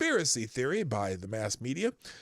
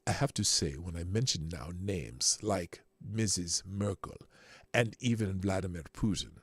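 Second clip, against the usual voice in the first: put-on low-pitched German accent; 7 semitones lower than usual. Host reading Schwab's full quote, low German accent. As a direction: read the quote as a deliberate, low German speaker. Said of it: "my best German accent".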